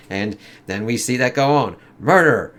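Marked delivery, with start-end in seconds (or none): 2.0-2.5 angry shout. Acted. Angels attack Verboten.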